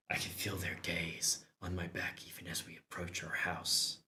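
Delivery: hushed whisper. Acted. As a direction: breathe it out. Whispered narration of being watched.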